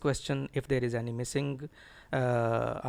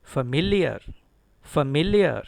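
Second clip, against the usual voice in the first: familiar with a loud, punched MIL; teacher's model familiar, MIL stressed; 6 dB louder than usual. Shown as a demonstration. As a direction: hit the MIL in familiar hard, then fall away. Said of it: "Stress is on M I L".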